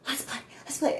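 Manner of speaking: high, playful dog voice; an eager pup begging to play; voicing the dog. Voicing the German Shepherd, high and eager.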